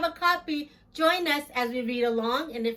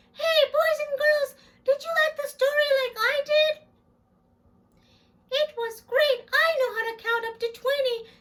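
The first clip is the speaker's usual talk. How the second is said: much higher, quicker little puppet voice; squeaky kid puppet chirping hello; 12 semitones higher, 3 dB louder than usual. Acted. Puppet Jackson greeting Miss Big, high and quick.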